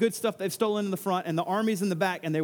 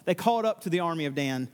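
ordinary talk, nothing marked

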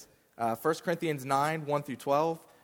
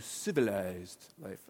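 a slow, lower, lightly put-on British accent on "civilized life"; mock British accent; 6 semitones lower, 4 dB quieter, slower than usual. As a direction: drawl it out like a posh British speaker, half in jest. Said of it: "in a British accent".

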